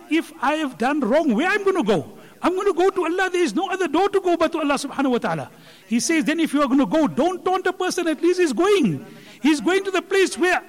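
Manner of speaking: preacher urging the congregation not to taunt sinners, fiery; fiery preaching. Acted. Full-force preaching.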